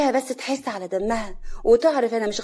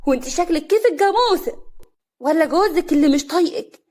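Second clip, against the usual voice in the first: loud, high, indignant quarrel; heated, raised-voice insults; angry; 5 semitones higher, 6 dB louder than usual. A narrator's reading. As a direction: hit back furiously at your rival. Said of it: "كريمة بغضب".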